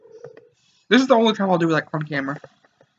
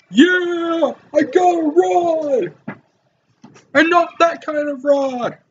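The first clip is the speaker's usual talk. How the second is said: much higher, slow, delighted cry; gleeful squeal; 10 semitones higher, 3 dB louder, much slower than usual. Acted. Player thrilled to receive the rod, high delighted squeal. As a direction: squeal it like a kid with a new toy.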